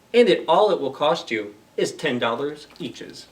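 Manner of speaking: animated storybook voice with bouncy rhyme; reading to children. Acted. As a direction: perform the rhymes for a young audience. Reads Seuss story aloud.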